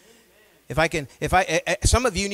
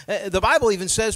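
usual speaking voice

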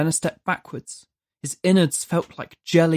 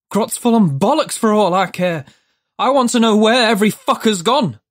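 louder, higher angry rant; angry outburst; 6 semitones higher, 4 dB louder than usual. A narrator's reading. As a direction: Judd rants at the demon, angry and loud. Judd angrily demands answers.

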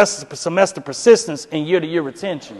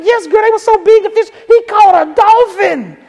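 insisting wife; 14 semitones higher, 4 dB louder than usual. Retold or imitated. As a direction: double down on the brag.

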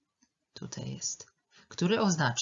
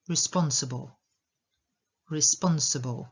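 "Responsible" with a strong SPON, weak schwa after; second-syllable stress; 3 dB louder than usual. Shown as a demonstration. Teacher showing learners "Responsible", stressed on the second syllable. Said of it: "I akcent na drugą".

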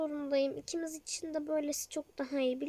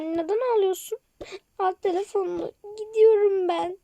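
crying; 5 semitones higher, 9 dB louder than usual. Acted. Blossom leaving in tears.

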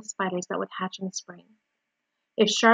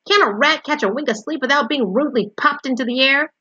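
loud, raised-pitch grumble; indignant growl; 5 semitones higher, 5 dB louder than usual. A narrator's reading. Rudely woken rat complains to Wilbur, growling. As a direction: complain loudly, thoroughly put out. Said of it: "he growled".

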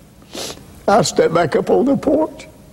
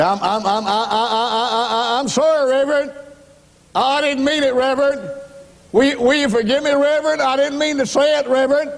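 stammering apology; 9 semitones higher than usual. Retold or imitated.

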